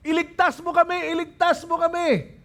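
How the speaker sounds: high, quick repeated plea; urgent, high-pitched appeal; crowd's plea. Acted. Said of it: "Ganun yung declaration nila".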